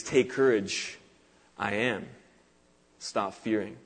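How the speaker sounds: soft and low, with pauses; hushed solemnity. Retold or imitated. Preacher giving Jesus's literal words, hushed and solemn. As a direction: let each short phrase drop quietly.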